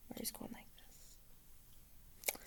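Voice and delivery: unvoiced, hushed mime; whispered aside. Real, not acted. Storyteller miming the dozing magician, whispered. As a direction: breathe it softly while miming sleep. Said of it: "miming the nodding magician".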